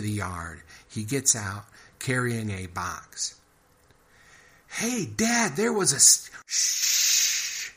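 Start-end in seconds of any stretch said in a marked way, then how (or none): 4.7-6.3 high, loud eager shout to dad; boy calling excitedly to his father. Acted. 6.4-7.7 loud hissed shush, cutting him off; sharp shush. Acted. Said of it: "Richard interrupts Roger".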